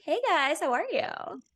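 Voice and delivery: high, loud put-on American greeting; American accent. Newcomer to America showing off the practised accent, exaggerated American. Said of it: "I didn't have an American accent".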